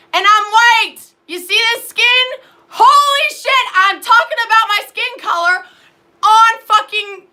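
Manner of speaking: loud, high, furious; worked-up shout. Real, not acted. Vlogger ranting at viewers about her skin, shouting. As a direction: yell it, losing control. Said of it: "Let me calm down".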